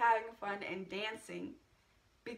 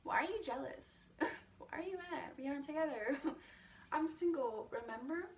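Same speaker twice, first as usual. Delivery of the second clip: inner sass; 4 semitones higher than usual. Retold or imitated. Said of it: "thinking in my head".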